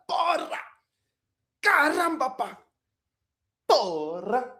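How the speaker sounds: high-pitched furious outburst at the TV, Portuguese curses; angry football yell. Retold or imitated. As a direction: scream at your losing team. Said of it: "He'd be like that".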